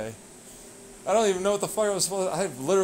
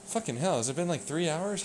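ordinary talk

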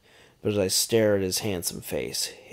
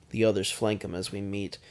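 usual speaking voice

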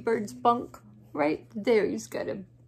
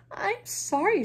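sorry raccoon; 10 semitones higher than usual. Acted.

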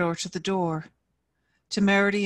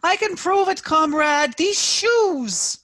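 high, loud desperate screech; old woman screeching her loyalty; screeched protest; 11 semitones higher, 7 dB louder, slower than usual. A narrator's reading. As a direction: shriek it out in panic. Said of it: "Yelena screeched her protest".

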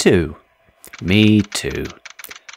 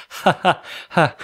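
laughing; 10 semitones higher than usual. Real, not acted. Laughing at own joke.

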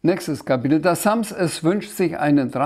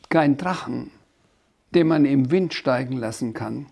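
ordinary delivery

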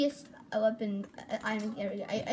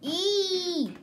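higher, louder hammed-up E; mock flamingo E; 6 semitones higher, 4 dB louder than usual. Boy mocking a YouTuber's catchphrase, higher and louder. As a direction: belt the E as a hammed-up parody. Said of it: "Now I'm making fun of the flamingo".

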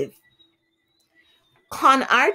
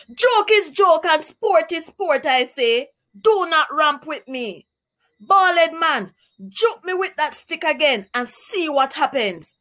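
higher pitched mock rage; raging parrot; 7 semitones higher than usual. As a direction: threaten him like an angry bird. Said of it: "raged Parrot".

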